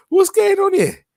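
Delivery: high, loud disbelieving cry; outraged shout. Real, not acted. Outraged at the news.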